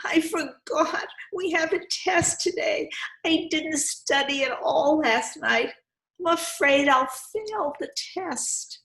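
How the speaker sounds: put-on high, anxious boy's voice; a panicky pupil who didn't study; worried. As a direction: fret about the test. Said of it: "he said".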